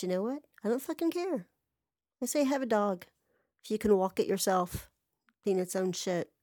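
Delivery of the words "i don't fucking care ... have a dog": defiant outburst. Real, not acted. Defiant about dog rule.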